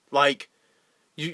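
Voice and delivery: ordinary talk, nothing marked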